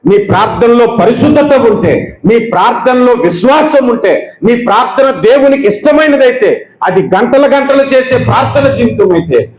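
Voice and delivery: rousing preacher building to a peak; building sermon climax. Acted. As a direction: stack the phrases and drive them upward.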